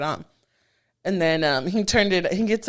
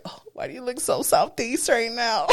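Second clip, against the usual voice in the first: higher, softer mock surprise; teasing mock question; 3 semitones higher, 5 dB quieter than usual. Woman teasing her man about his look, mock surprise. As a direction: tease him in a light, raised voice.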